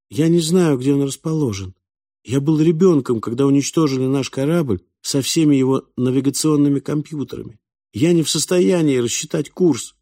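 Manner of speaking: put-on high, earnest boy's voice; plaintive. A narrator's reading. The boy explains to Falk why he is stranded, plaintively. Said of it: "жалобно произнёс мальчик".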